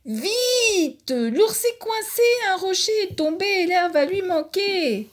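tiny high voice calling for help; panicked ant cry for help. Acted. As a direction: squeak out an urgent plea. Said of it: "appelle au secours".

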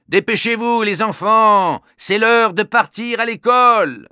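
a hint of a hurrying dad; father calling out. The father hurrying the children to school, lightly voiced dad calling. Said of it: "La grosse voix du père".

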